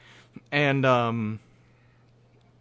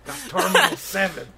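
quick Boston-style punchline, laughing; mock Boston wiseguy line; Boston bit; 4 semitones higher than usual. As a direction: toss it off in a jokey Boston voice.

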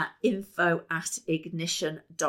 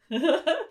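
light laugh; 10 semitones higher, 5 dB louder than usual. Real, not acted. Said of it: "laughs after chant".

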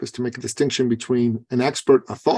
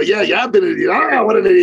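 teasing, louder agreement; mock agreement; 7 semitones higher, 5 dB louder, faster than usual. Coach teasing his client, playfully louder. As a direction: rib him good-naturedly.

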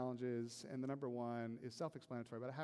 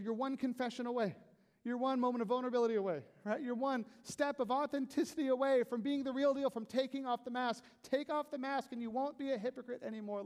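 higher and louder, urgent call to step out; full-force preaching; 10 semitones higher, 8 dB louder than usual. Acted. Pastor urging listeners to drop the mask, full force. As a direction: preach like you're pulling them forward.

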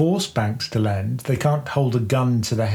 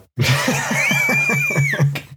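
high shouting burst of laughter. Real, not acted. Laughing at own pirate joke.

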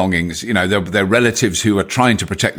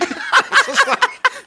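high embarrassed laugh. Real, not acted. Laughing at own story.

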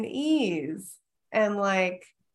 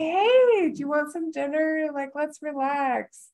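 hostess kindness; 7 semitones higher than usual. Pretend. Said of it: "you would just be like".

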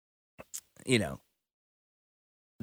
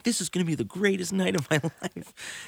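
overexcited; 10 semitones higher than usual. Retold or imitated. Mocks big hopes for a gig.

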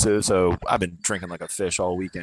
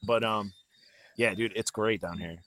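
usual speaking voice

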